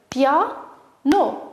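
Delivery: "Piano" in two separated beats, pia, no; split into syllables. Shown as a demonstration. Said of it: "découper syllabiquement les mots".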